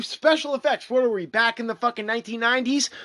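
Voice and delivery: normal talking voice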